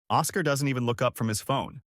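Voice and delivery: plain reading voice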